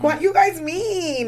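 high, drawn-out mock-wounded question; retold plaintive protest. Viewer asking the sisters why they're mean, retold plaintive protest. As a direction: stretch it out high, pleading on someone's behalf.

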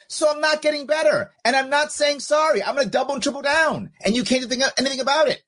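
high, worked-up mock defiance; defiant. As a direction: crow it like someone who refuses to back down. Voicing the defiant cancelled person.

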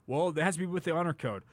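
ordinary talk, nothing marked

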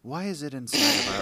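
loud rising exasperation; shouting. Acted. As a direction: yell it at her in disbelief. Shouting before breaking.

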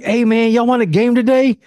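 hyped for game day; excited. Retold or imitated. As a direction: friends calling him to play, excited yes. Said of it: "when my homies call".

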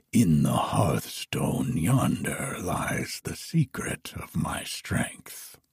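low, hushed dragon voice, fading to a whisper; lying dragon, low and hushed. A narrator's reading. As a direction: tell the secret low and sly like a dragon. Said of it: "and answered".

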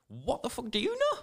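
high-pitched incredulous challenge; indignant retort. Retold or imitated. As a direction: retell it as a squeaky, indignant comeback. Retold confrontation with intruder.

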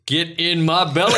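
high, loud laughing monster voice; hammed-up Fat Bastard. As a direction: growl it out like a greedy ogre through laughter. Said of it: "there's a narrator goes".

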